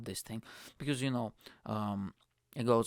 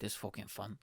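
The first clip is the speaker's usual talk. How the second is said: ordinary talk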